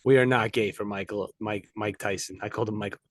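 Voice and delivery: low, subdued mock-serious statement; mock-solemn disclaimer. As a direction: set the record straight, playfully grave. Mock-serious disclaimer about Mike Tyson.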